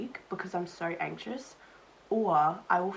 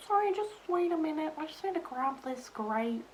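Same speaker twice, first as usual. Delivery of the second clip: mock polite server voice; 9 semitones higher than usual. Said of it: "I can't really just be like".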